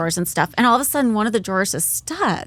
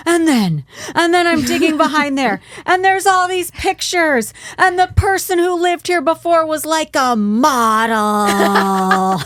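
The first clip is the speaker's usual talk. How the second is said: put-on higher, louder breathless child's tale; dramatic kid; 7 semitones higher, 4 dB louder, slower than usual. Son reports the pictures to his dad, high and dramatic. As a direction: spill it out dramatically. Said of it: "how dramatic it was".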